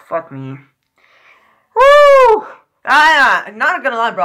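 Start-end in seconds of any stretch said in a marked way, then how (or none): 1.8-2.5 high, loud "woo"; excited whoop. Real, not acted. Viewer reacting to the game highlights, excited whoop. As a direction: let out one sustained high whoop.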